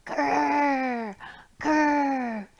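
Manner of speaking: light playful grr grr; bear grr. Acted. Teacher growling along in the song, mock grr. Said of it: "you're gonna go, Grr".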